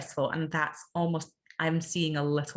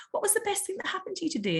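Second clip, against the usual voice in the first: bright questioning; much faster than usual. Retold or imitated. Voicing her own question.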